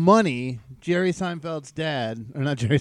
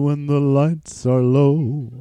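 dramatic movie quote; 3 semitones lower, 7 dB louder, slower than usual. Retold or imitated. Slow dramatic quote of movie line.

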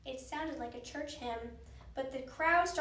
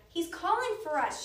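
high, louder urgent call; shouting; 5 semitones higher, 6 dB louder than usual. A narrator's reading. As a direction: call out to her brother. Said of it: "shouted Annie".

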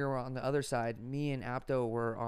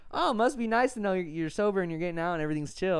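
teasing auntie; 5 semitones higher, 5 dB louder than usual. Retold or imitated. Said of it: "This time she was like".

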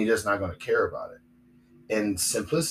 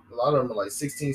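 ordinary talk

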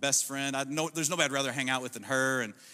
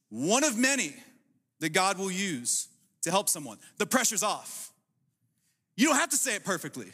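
ordinary delivery

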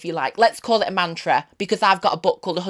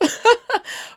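squeaky laugh. Real, not acted. Laughs at fake cough.

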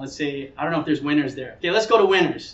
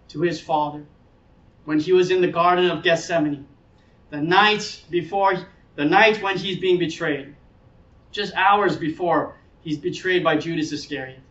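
usual speaking voice

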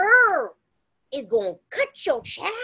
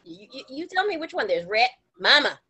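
ordinary talk

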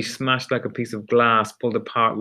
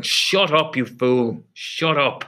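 higher-pitched, irritated command to be silent; angry cut-off; 4 semitones higher, slower than usual. Acted. Superior silencing the guard as he begins to speak, angry. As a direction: cut them off with sharp contempt.

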